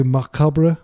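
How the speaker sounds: Macabre as mah-KAH-bruh, French style; French pronunciation. Shown as a demonstration. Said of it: "which is how you pronounce it in French".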